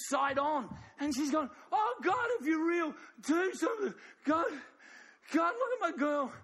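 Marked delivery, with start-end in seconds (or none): 1.7-6.3 mother's desperate plea. Acted. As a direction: cry out to heaven for your sick girl. Mother pleading in sermon.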